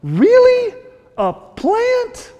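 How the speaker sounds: squeaky high disbelieving question; mock astonishment at the silly plant; incredulous God. Preacher voicing God asking Jonah about the plant, high incredulous squeak. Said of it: "call that the theme of the book".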